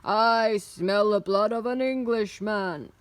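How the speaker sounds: loud, threatening giant voice; roaring giant. A narrator's reading. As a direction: roar it on the hunt for prey. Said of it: "roared the giant".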